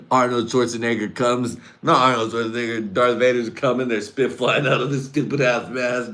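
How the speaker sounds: ordinary talk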